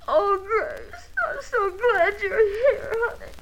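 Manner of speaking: high, slow, crying with relief; tearful relief. Acted. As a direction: sob it out as she clings to her sister. Distraught wife greets her sister.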